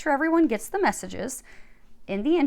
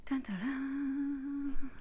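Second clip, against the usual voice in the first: suspense dun dun dun; 3 semitones higher, 7 dB quieter, much slower than usual. Pretend. Mock suspense before Virgo reading.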